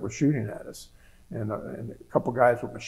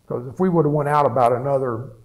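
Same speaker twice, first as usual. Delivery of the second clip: normal talking voice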